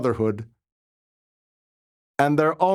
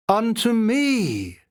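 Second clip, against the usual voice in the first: slow, higher, rising wonder on "me"; awed exclamation; 7 semitones higher, slower than usual. A narrator's reading. The monk echoes the voice in awe, slow and high. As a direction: stretch "Unto me" out in awe. Echoing the inward voice.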